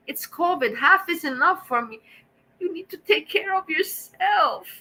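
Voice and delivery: worked up, pitch riding high; real upset, voice lifted and strained with feeling; emotional. Real, not acted. Streamer telling viewers of a needy woman's kindness, emotional. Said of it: "Sorry, I got emotional".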